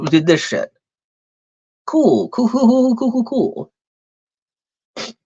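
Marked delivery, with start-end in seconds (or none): 1.9-5.1 sarcastic cool. Real, not acted. Sarcastic reaction to cops.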